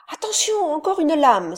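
high, loud urgent shout; warning cry. A narrator's reading. Cabin boy Moko shouts a warning of another wave. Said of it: "s'écria Moko".

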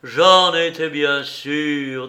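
loud, slow, hearty assurance; joyful. A narrator's reading. Miller unfooled by his uncle, cheerful loud voice. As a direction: declare it with cheerful confidence. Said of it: "dit le meunier d'un air joyeux".

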